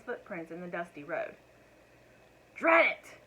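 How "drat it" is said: high, loud exasperated curse; thundering curse. A narrator's reading. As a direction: thunder it out at the rain. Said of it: "thundered Mister Wagon Wheel".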